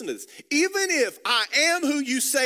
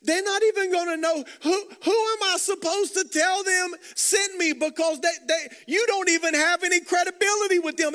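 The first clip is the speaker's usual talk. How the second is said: louder, higher urgent protest; raised, pleading Moses; 8 semitones higher, 4 dB louder than usual. Acted. Preacher voicing Moses' doubt to the congregation, louder and higher.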